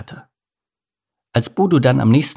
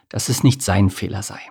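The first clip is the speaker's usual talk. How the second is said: ordinary delivery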